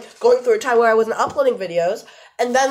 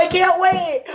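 ordinary delivery

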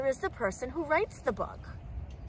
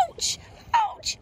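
very high, squeaky little yelp; crying out in pain. A narrator's reading. Crab hurt by his shattered shell, high cry of pain. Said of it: "he cried".

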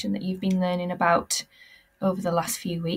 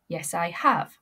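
ordinary talk, nothing marked

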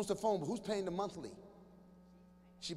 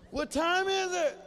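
higher, slow, drawn-out boredom; bored kid; 8 semitones higher, 4 dB louder, slower than usual. Retold or imitated. Kid stuck alone in a bare bedroom. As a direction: sound endlessly stuck.

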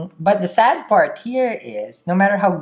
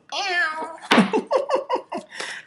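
high, drawn-out kitty meow breaking into laughter; cat meow and giggle; 12 semitones higher, 4 dB quieter than usual. Acted. Reader likening a cute Leo to a cat, high meow then laughing. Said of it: "They're like a cat".

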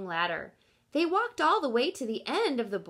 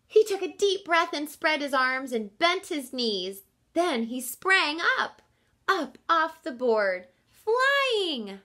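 louder and higher, soaring up to flying; excited build; 6 semitones higher, 6 dB louder than usual. A narrator's reading. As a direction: launch the voice into the air. Triumphant jump.

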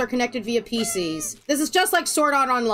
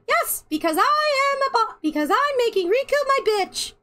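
Sora voice; 11 semitones higher than usual. Acted. Acting as Sora, gloating.

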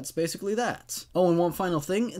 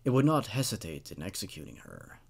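steady, measured reading of a dark tale; storyteller reading a horror tale aloud; horror story narration; 4 semitones lower, 4 dB quieter than usual. A narrator's reading.

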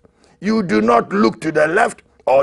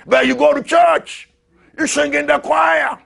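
higher, louder preacher's jab; fiery preaching; 10 semitones higher, 3 dB louder than usual. Acted. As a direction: hammer the point home. Preaching at full force.

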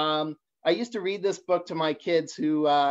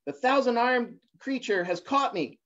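cry for help; 8 semitones higher than usual. A narrator's reading. Little blue fish crying out to rainbow fish, terrified. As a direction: shriek for help, caught and scared. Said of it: "the little blue fish cried out".

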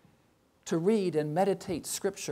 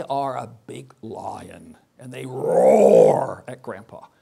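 lion roar; 6 semitones lower, 13 dB louder, slower than usual. Retold or imitated. Kids playing lion game.

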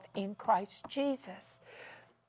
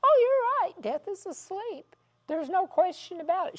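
admitting surprise; 8 semitones higher than usual. Retold or imitated. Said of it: "You know what she says".

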